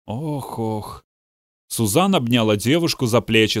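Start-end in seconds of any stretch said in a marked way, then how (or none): 0.1-1.0 two soft groans of pain. A narrator's reading. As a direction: groan quietly from the broken rib. Injured girl with broken rib.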